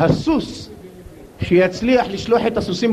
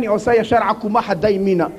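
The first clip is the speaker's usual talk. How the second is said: ordinary delivery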